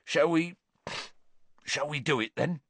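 mildly shaky, hesitant; halting, barely holding together. A narrator's reading. Hagrid to Slughorn before the burial, mildly shaky. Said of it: "in a shaky voice".

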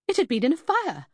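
incredulous. A narrator's reading. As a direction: state the obvious in high-pitched incredulity. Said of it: "she responded incredulously".

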